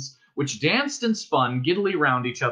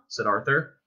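ordinary delivery

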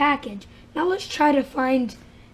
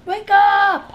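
wake up call; 7 semitones higher, 9 dB louder than usual. Real, not acted. Waking the sleeping toy.

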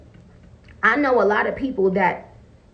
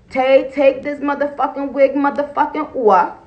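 ordinary talk

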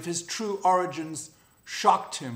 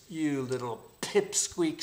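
plain reading voice

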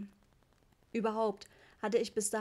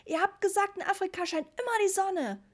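put-on high, loud cross little boy; a small kid bawling out his parents; child yelling; 10 semitones higher, 5 dB louder than usual. A narrator's reading. Ritchie yells at his parents about the rain, high and loud. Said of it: "brüllte Ritchie".

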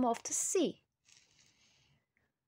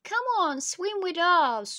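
high, louder call to a friend; shouted invitation; 6 semitones higher, 4 dB louder than usual. A narrator's reading. Boy's friends calling him to swim, shouted invitation. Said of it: "shouted the boy's friends".

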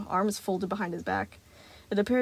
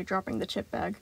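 usual speaking voice

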